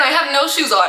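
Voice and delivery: usual speaking voice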